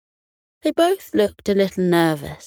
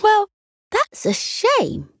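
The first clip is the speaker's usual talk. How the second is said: high, slow, pitying tone; sympathetic; 14 semitones higher, slower than usual. Acted. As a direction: feel sorry for the cats. Dog sympathizes with cats.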